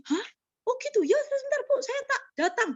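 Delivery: high surprised caller voice; a startled man, voiced high; mock surprise. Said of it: "caller reacts". (kaget dengan suara tinggi)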